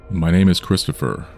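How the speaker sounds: usual speaking voice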